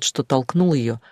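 plain reading voice

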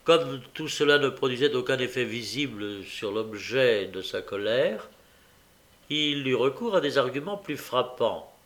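ordinary delivery, nothing marked

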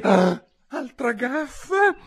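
put-on a dismayed girl catching her blunder; exclaimed dismay. A narrator's reading. Alice exclaiming as the mouse swims off. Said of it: "esclamò".

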